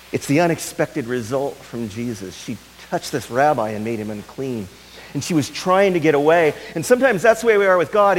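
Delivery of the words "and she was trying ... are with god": high, loud and fast; impassioned preaching. Acted. Preacher urging the congregation about God, fast and loud. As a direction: press the point urgently.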